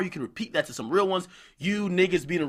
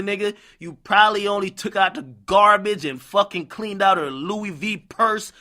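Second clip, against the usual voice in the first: ordinary talk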